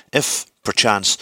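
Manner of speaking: plain reading voice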